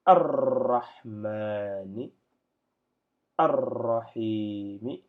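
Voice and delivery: "Ar-Rahmani, Ar-Rahimi" drawn out very slowly and loud, doubled R held; slow loud Quran-style recitation; joined reading. Shown as a demonstration. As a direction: sing the joined Arabic words slowly, leaning on the doubled R. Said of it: "si je devais lire en attaché".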